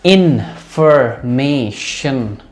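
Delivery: Information in four slow, stretched beats; syllable by syllable. Shown as a demonstration. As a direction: break information into in-fer-may-shun. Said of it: "चार syllable वाले word".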